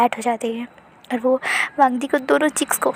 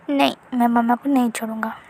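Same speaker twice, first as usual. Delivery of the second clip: plain reading voice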